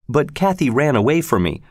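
usual speaking voice

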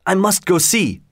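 high-pitched, insistent plea; begging. A narrator's reading. Leroy begging Jarvis to let him see, insistent. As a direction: plead eagerly, pitched well up. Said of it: "begged Leroy".

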